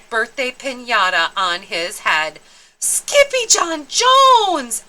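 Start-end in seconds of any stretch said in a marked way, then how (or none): 2.8-4.8 high, loud, slow cry of a name; stretched-out alarmed call; shocked cry. Acted. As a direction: everyone shouts in surprise. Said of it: "everyone cried".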